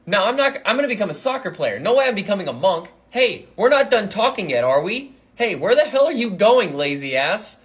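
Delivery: high-pitched, hurried outburst; indignant protest. Acted. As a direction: call after someone walking off on you. Acted indignant protest, calling after him.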